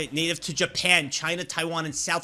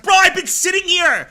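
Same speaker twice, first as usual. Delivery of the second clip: frustrated outburst; 13 semitones higher, 13 dB louder than usual. Retold or imitated. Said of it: "I'm just like".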